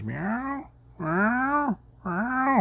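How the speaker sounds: three high, thin mock meows; imitated cat meows. Mimicking his old cat meowing before she drinks. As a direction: meow like an old deaf cat at the water bowl. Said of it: "she stands there and she goes".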